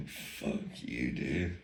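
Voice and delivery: low, unvoiced muttered curse; muttered under breath. To the Aussie instructor, a muttered curse under his breath.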